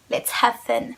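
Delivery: playful little attempt at an English accent. Shown as a demonstration. Vlogger showing off a perfume name to viewers, English accent. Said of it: "Vous avez vu le petit accent anglais".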